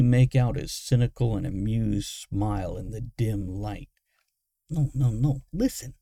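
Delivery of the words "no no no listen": high, mumbled murmur; mumbling. A narrator's reading. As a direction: mumble it with barely moving lips. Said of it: "Moggitz mumbled, barely moving his mouth".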